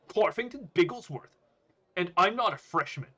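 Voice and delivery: loud, high student voice; high, flustered reply; protesting student. Acted. Student answers the shouting bully.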